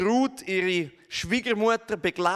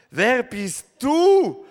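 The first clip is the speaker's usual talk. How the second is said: slow, loud, high startled question; 6 semitones higher, 8 dB louder, much slower than usual. Acted. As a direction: ask who's there, startled. Boas startled by woman.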